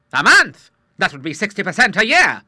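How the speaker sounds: high, loud outraged gasp of numbers; aghast at the rate; shocked disbelief. Acted. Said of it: "But that is monstrous".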